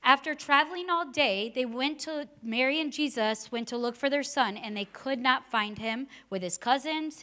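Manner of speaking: ordinary delivery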